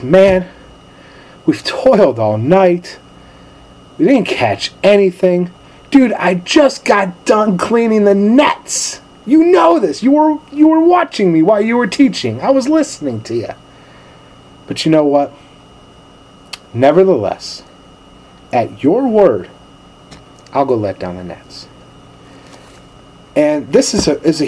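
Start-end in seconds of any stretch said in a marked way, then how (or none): 0.0-13.5 weary complaint. Retold or imitated. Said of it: "Peter shows our humanity. He says". 14.7-21.7 resigned surrender. Retold or imitated. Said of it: "Peter shows our humanity".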